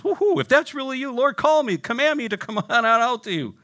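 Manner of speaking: high, loud, giddy whoop; hammed-up excited shouting, laugh breaking in; Peter. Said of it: "And Peter goes".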